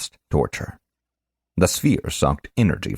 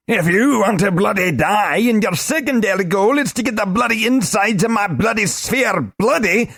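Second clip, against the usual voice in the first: angry little man. Acted. Globin raging at Korash, high sputtering shout. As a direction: splutter out the curses. Minder ranting warning.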